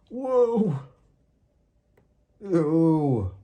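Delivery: high, loud startled cries; startled burglar yelps. Acted. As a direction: yelp in shock as you fall. Burglars stumble into traps.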